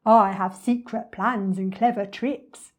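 boastful storybook villain; scheming crocodile. Acted. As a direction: savour it slowly like a scheming crocodile.

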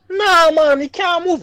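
high, loud mock lament; mock sad Jamaican guy. Imitating the Jamaican guy sad at his leaving, mock distress. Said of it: "yo, he was sad".

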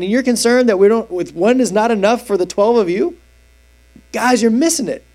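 high, incredulous, scolding the disciples; a teacher who can't believe they missed it; exasperated Jesus. Retold or imitated. Jesus scolding his disciples over bread, exasperated.

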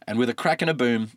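ordinary delivery

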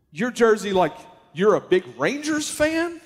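surprised at the seller's jersey; surprised question. Retold or imitated.

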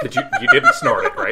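laughing high and loud; asking through a laugh. Real, not acted. Joking about snorting bacon powder.

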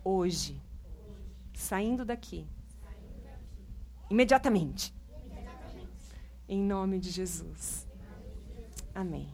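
slow, soft closing prayer. Real, not acted. Pastor closing a prayer for the church, slow and soft. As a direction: pray it out gently and slowly.

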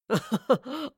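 smug mocking chuckle; a short gloating laugh; villain laugh. A narrator's reading. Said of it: "Wayland laughed".

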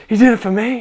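loud, high joyful cry of realisation; excited realisation. Retold or imitated. Friend finally grasping the gospel, crying out in joy. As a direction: let it burst out, high and loud. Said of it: "started being like".

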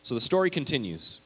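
normal talking voice

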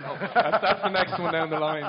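loud, laughing through the words. Real, not acted. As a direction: laugh as you say it. Joking about a players' association.

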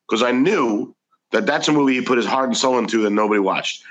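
usual speaking voice

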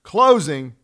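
Closing punched out loud after a long silence; stress on closing. Acted. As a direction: land Closing as the big reveal. Punchline word of the analogy.

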